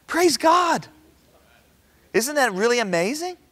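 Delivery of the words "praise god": high, loud cry of praise; a preacher's shout of praise; exultant praise. Real, not acted. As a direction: lift it up in joy.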